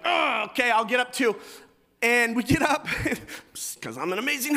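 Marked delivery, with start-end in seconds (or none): 0.0-1.3 louder, higher reluctant agreement; loud grudging give-in. Retold or imitated. Husband giving in to wife, loud and grudging. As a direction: cave in loudly. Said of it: "I was like".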